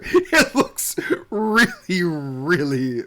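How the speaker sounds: words squeezed through laughter; cracking up mid-sentence; laughing. Real, not acted.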